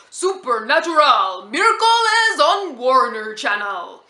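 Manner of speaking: booming, drawn-out TV promo announcer; testosterone-laden voiceover guy. Retold or imitated. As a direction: imitate an over-the-top macho channel voiceover, loud and slow. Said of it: "this testosterone-laden voiceover guy would come on and go".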